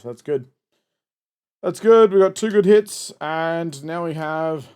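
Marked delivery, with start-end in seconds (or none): 1.6-3.1 louder, higher, pleased; excited. Real, not acted. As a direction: cheer the good grades. Repeats it, second time excited.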